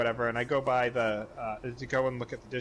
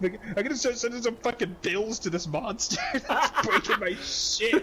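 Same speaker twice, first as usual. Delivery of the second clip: high, laughing punchline; 11 semitones higher, 3 dB louder than usual. Real, not acted. Teller cracks up finishing the monster story, laughing. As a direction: laugh through the last line.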